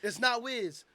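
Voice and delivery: security guard. Retold or imitated. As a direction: bark orders at the fan. Security guard ordering him off.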